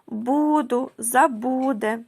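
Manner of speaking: "буду, забуде" with БУ drawn out in each word; penultimate-syllable stress. Shown as a demonstration. Teacher showing feminine rhyme, "буду, забуде" stretched on the next-to-last syllable. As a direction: lean long on БУ in "буду" and "забуде". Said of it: "У нас наголос падає на передостанній склад".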